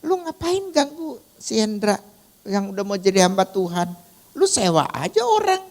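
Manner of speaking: imitating a scolding in a very high-pitched voice; high-pitched mock telling-off; scolding older brother. Older brother scolds her for bothering Hendra, high-pitched scolding. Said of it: "abang saya marahin dia".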